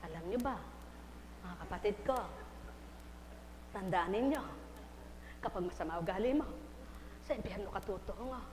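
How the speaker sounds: low and soft warning; demonstrating a quiet sermon. Shown as a demonstration. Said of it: "Eh, ihinaan natin".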